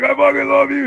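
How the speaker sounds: high, loud yell of brotherly love; acted post-fight euphoria, high and loud; re-enacted elated shout. Acts own elated shouted reply to opponent.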